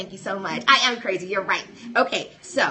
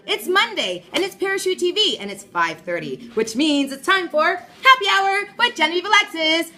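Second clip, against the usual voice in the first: higher, louder hype announcement; excited show intro; 7 semitones higher, 4 dB louder than usual. Real, not acted.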